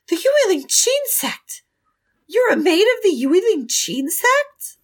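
high, surprised woman asking; female voice. Acted. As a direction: ask in disbelief, high.

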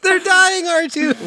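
very high, fretful robot sidekick voice; mock distressed droid. Quoting Star Wars to the droid R2 while hollering at the movie, hammed-up high distress. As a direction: wail it in a high-pitched panicky impression. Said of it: "And then they're like".

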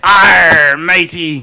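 loud mock pirate cry; sea captain. Joining the joke about the accent, loud sea captain. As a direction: belt it out like a hammy sea captain. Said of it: "you sound like a sea captain".

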